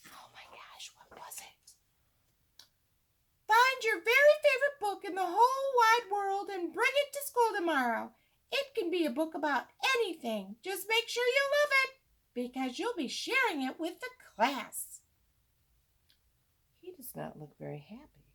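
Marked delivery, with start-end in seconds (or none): 0.1-1.7 hushed suspenseful whisper. A narrator's reading. Narrator building suspense for young listeners, whispering. 3.5-15.0 high, louder cheerful teacher voice; Missus Joy. Acted. Teacher giving the class homework, high cheerful teacher voice.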